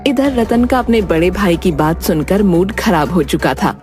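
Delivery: ordinary delivery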